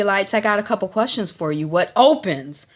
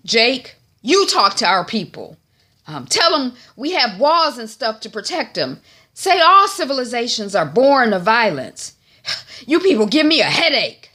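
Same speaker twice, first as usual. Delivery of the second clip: loud, raised-pitch acted bossiness; exasperated orders; 7 semitones higher, 6 dB louder than usual. Father ordering his son to address people, exasperated. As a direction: issue orders, fed up with everyone.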